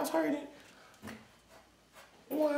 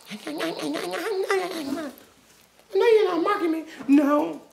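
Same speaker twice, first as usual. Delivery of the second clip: loud play-acted mom scolding; loud back-and-forth with mommy; pretend mom and child argument; 2 semitones higher, 8 dB louder than usual. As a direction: act out mom catching you. Kid plays mom and himself.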